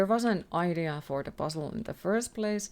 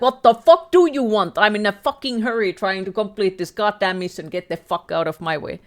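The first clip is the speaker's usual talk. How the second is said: loud, exasperated rant at the monster; pissed off; 2 semitones higher, 8 dB louder than usual. Acted. Streamer to the monster chasing them, pissed off. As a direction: start at a loud yell, easing as you go. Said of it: "I'm gonna be pissed".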